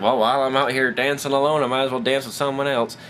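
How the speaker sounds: lightly put-on Southern woman; casual mock Southern accent. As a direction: voice her with a Southern twang. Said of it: "a southern accent".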